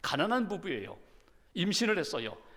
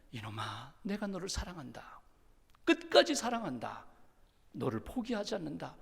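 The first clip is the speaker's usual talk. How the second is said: much softer, lower, loving assurance; tender reassurance; 3 semitones lower, 7 dB quieter than usual. Acted. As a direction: say it softly, like holding him close. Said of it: "어떻게 느껴지는가 하면".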